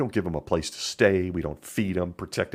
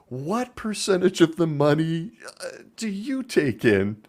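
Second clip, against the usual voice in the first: sarcastic mock outrage; 7 semitones higher than usual. Real, not acted.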